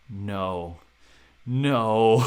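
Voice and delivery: second no bursts out loud; dismayed no. Retold or imitated. Heart sank, dismay.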